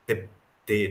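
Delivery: normal talking voice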